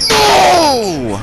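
loud high anguished cry; anguished scream. Real, not acted. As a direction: scream as the character dies. Dies to the boss.

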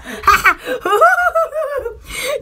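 high helpless laughter that won't stop; real giggling fit, breathless and high; long laughing fit. Real, not acted. Laughing at her own story, a helpless giggling fit.

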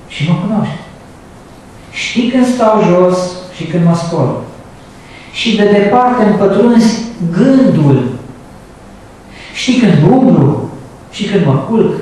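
slow, solemn scripture reading; drawn-out solemn reading from the pulpit; psalm reading. Acted. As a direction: read the psalm slowly, savouring each line. Reads Psalm 139 aloud.

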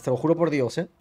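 normal talking voice

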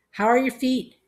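loud, high shouted question; thundering. A narrator's reading. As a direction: thunder it at someone hard of hearing. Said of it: "Grandma thundered at her".

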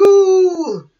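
high, loud "ooh" of a cheering crowd; imitated cheering crowd. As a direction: cheer out a long, high whoop. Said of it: "everyone is like cheering".

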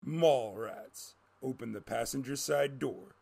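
ordinary delivery

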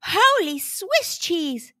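high, drawn-out amazement; amazed exclamation. A narrator's reading. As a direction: exclaim slowly in astonishment. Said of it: "exclaimed Blue".

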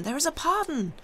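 very high, excited call; crowd's cry. A narrator's reading. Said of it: "the crowd cried in unison".

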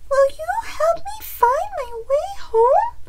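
very high, loud little hen voice; pleading chicken. A narrator's reading. Chicken asks Bear for help home, high squeaky request. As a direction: ask squeakily as a small chicken. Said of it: "Chicken said as she rubbed her tummy".

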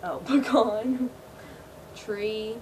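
ordinary delivery

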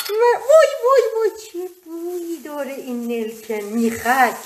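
high loud wow, then gushing; excited exclamation. Real, not acted. Opening the cloves for viewers, high excited gush. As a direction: cry out at the smell.

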